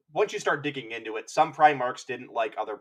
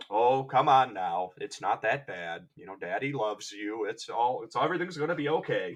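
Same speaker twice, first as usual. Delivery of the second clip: a mock gentle older brother calming a sulking sibling; reassuring older brother; 4 semitones lower than usual. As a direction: comfort him like a sitcom dad. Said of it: "the older brother that's".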